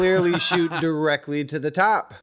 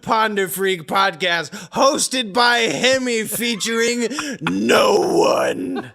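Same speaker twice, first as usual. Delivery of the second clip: hammed-up announcer voice, louder; mock announcer; 5 semitones higher, 3 dB louder than usual. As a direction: grandly announce the show. Mock announcing solo podcast.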